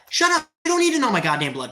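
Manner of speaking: high, loud shut up; furious snap. Real, not acted. Streamer cutting off a blood-type question, furious. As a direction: spit it out at the troll. Angry reply to chat.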